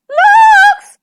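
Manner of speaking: very high, loud squawk; piercing chicken squawk; squawking hen. A narrator's reading. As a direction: shriek it like an alarmed hen. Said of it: "squawked Missus Chicken".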